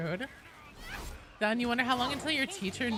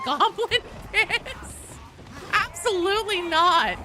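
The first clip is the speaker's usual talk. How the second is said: slow, laughing, higher pitched question; laughing disbelief; 11 semitones higher, 5 dB louder than usual. Real, not acted. Laughing at chat suggestion.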